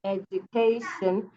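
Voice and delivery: "education" said slowly and clearly, each syllable even; slow model. Shown as a demonstration. Teacher modelling the word slowly for students. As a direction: draw it out for the class.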